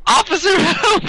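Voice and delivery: high Southern yelp for help; Southern accent. Pretend. Friend mocks the Southern accent phrase, high Southern cry. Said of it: "one thing I could always say really well in a Southern accent".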